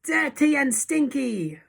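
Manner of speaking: high, loud crowd cry; audience cry. A narrator's reading. Witches cheering on their leader, excited high cry. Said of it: "cried the audience".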